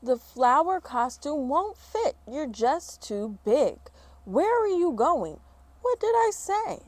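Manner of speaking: slow, loud, then very high; blunt teacher. Acted. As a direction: lay out the verdict, then feign surprise. Teacher says costume won't fit.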